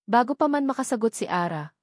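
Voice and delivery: ordinary delivery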